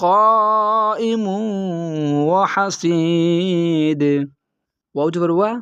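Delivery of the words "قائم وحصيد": slow, drawn-out melodic tilawat; Quran recitation. Shown as a demonstration. Teacher reciting the verse for students, slow melodic chant. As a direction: chant each word long and sustained. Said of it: "recites the verse after spelling".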